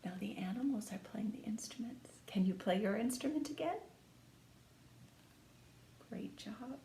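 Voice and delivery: hushed whisper to the little listeners; whispered aside. Acted. Reader whispering to the children mid-story, hushed. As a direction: lean in and whisper softly.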